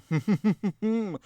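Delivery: high, mock-sinister snicker; a quick villainous snicker, hammed up; terrifying snicker. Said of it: "simple but terrifying".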